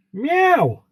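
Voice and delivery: very high, playful mock cat meow. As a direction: squeak out a cat's meow up high. Viewer named Catwoman greeted.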